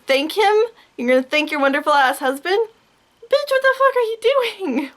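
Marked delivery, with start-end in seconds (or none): 3.2-4.9 shrieking disbelief, way up high; high outraged yell. Real, not acted. Yelling at the singer for thanking him, high and outraged. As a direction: screech it at her in outrage. Ranting at [name]'s mother on the show.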